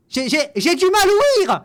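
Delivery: hammed-up loud stammer, pretending to be hard of hearing; loud mock stammer at a comment he dislikes; mock loud complaint. Pretends not to hear comment.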